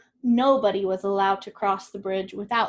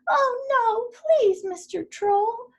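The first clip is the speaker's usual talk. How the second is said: much higher, begging goat voice; pleading little goat; 12 semitones higher, 6 dB louder, slower than usual. A narrator's reading. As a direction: beg the troll in a tiny squeak. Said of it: "pleaded the goat".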